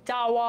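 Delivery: "চাওয়া" with a long open ending; fully pronounced. Shown as a demonstration. Teacher showing the full sound, "চাওয়া" stretched. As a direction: draw out both syllables of "চাওয়া". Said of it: "পূর্ণরূপে উচ্চারণ হচ্ছে".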